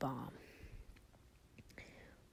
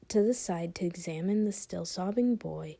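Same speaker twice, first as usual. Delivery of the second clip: ordinary delivery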